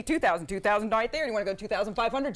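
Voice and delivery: quick auctioneer bid-calling at speaking volume; rapid auctioneer talk. Shown as a demonstration. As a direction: run the bid through in auctioneer patter. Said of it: "show you how to do it".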